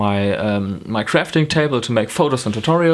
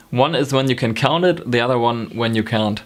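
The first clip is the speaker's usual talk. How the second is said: ordinary talk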